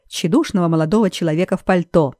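ordinary delivery

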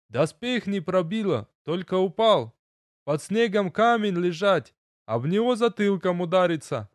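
a dwarf grieving in clumsy words; gruff gravelly dwarf voice. Acted. Dwarf explains, excited.

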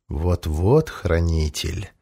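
low, slow, velvety dragon voice; a calm, menacing dragon speaking slowly; velvet dragon voice. A narrator's reading. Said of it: "голос опять зазвучал". (низкий бархатный голос дракона)

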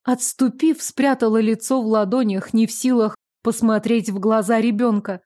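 usual speaking voice

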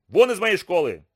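loud angry bark. Acted. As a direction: throw him out, barking. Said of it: "рявкнул он".

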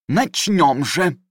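slow, high, grand proclamation; proclaiming judge. Acted. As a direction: announce it slowly like a pompous judge. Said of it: "провозгласил судья".

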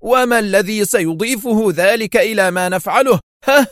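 high, angry challenge; heated question pitched high; angry exclamation. A narrator's reading. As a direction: demand an answer angrily. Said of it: "هتف فينتورا في غضب".

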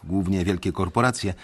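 ordinary talk

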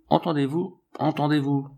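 a touch of alarm, pitch lifted; mildly alarmed cry. A narrator's reading. Old man warns of the threatening crowd outside, mildly alarmed cry. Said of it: "s'écria le vieillard".

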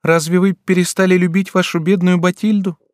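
high plaintive daughter's plea; pleading daughter. A narrator's reading. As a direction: ask like a hurt young girl. Sick daughter asks father.

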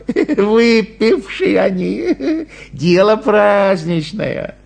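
drawn-out high chuckling voice; amused excuse. Acted. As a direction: excuse them with a slow, high laugh. Tipsy holiday crowd excused indulgently. (снисходительно, со смешком)

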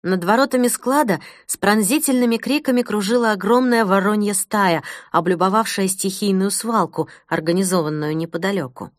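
ordinary delivery

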